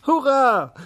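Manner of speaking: very high, loud joyful cheer; hooray. A narrator's reading. Little day cheering at the sunbeams. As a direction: burst out in pure joy. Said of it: "rief der kleine Tag".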